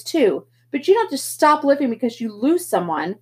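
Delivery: big brother shouting at Pony; angry older brother. Acted. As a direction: lay down the law, furious. Darry yells back in argument.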